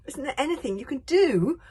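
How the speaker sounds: high loud pleading; desperate plea. A narrator's reading. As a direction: beg in panic. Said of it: "asked Hiccup desperately".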